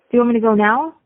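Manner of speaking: ordinary delivery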